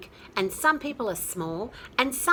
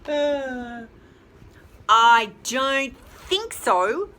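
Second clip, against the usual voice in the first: loud, slow, mock-stern chick; big chick's reply; 7 dB louder, much slower than usual. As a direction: draw it out firmly, loud. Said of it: "said the big humongous chick".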